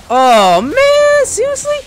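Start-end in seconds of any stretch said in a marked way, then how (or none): none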